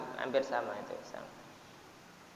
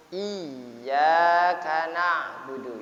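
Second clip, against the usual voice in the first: Iyyaka na'budu slow and louder, leaning on the doubled yy of Iyyaka; pressed shadda; 5 dB louder, much slower than usual. Shown as a demonstration. Teacher demonstrating the pressed shadda, slow and loud. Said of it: "sengaja ditekankan".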